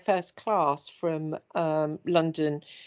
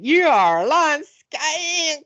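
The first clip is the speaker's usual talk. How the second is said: slow, higher, louder mock Southern drawl; Southern accent; 7 semitones higher, 5 dB louder, much slower than usual. Mother and son in car repeating insult in Southern accent. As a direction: ham up the Southern accent line. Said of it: "we kept doing it over and again".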